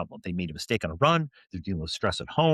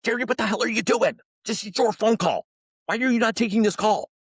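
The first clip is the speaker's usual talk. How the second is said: high, urgent covered-phone scolding; angry half-voiced rebuke, pitched high; exasperated hissed scolding; 13 semitones higher, 3 dB louder than usual. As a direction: scold him with the phone muffled. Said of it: "covered up the phone and go".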